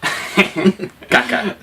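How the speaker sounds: breathy giggle on a silly word. Real, not acted. Childish joke word, laughing.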